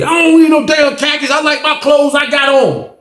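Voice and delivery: high, loud, indignant shout; snapping at her. Retold or imitated. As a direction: bark back the refusal like a stubborn inmate. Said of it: "Smitty snap on the lady".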